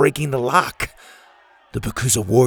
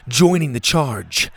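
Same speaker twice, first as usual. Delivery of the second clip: ordinary delivery, nothing marked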